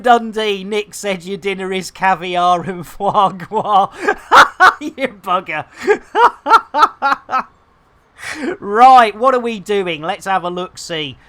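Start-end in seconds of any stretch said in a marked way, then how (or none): 3.9-4.8 high, loud burst of laughter; real laughter. Real, not acted. Laughs at viewer's joke. 5.7-7.4 long, high, loud cackling laugh; real laughter. Real, not acted. Laughs on at viewer's joke.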